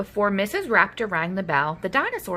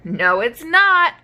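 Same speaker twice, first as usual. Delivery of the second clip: defiant cry; 4 semitones higher, 6 dB louder, slower than usual. Acted. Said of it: "cried Minosaur".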